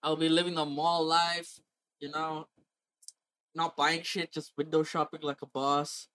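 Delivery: normal talking voice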